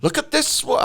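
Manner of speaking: badly faked Welsh accent. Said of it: "I can't do Welsh".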